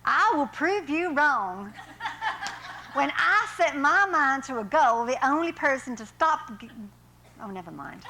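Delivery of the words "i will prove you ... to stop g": put-on laughing southern accent, higher and louder. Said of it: "I'm gonna read that again in southern".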